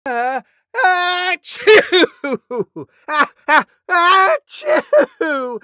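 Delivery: slow, very high, loud sneeze; disgusting sneeze. Acted. Deer shows a huge disgusting elephant sneeze. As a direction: ham up a gross sneeze. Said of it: "huge, disgusting sneeze".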